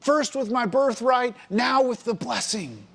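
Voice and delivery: high-pitched, drawn-out indignation; frustrated, upset. Acted. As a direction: protest the betrayal, pitched high and slow. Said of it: "began being frustrated and began to become upset".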